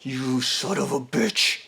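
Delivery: low, soft, slow snarl; menacing growl. Retold or imitated. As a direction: seethe the words out slowly. Fred confronts the survivor.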